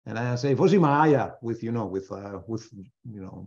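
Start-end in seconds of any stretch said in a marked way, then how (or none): 0.5-1.3 put-on "Vozi Maya" in a thick German accent, said louder. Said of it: "my German accent".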